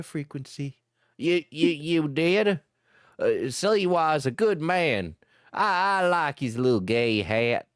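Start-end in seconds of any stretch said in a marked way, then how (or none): 1.2-7.7 softened hillbilly; calmed Shallot. Acted. Shallot calms down hearing Diesel voted Sliwa, lightly voiced. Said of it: "His exclamation seemed to calm Shallot".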